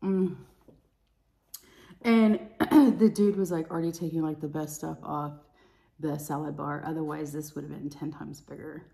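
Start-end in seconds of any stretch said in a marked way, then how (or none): none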